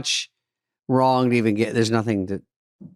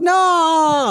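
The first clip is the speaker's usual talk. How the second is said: high, loud wailing no; mother wailing at the half hour left, high loud; 5 dB louder than usual. Retold or imitated. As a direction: howl it like heartbreak. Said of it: "mom's wailing no".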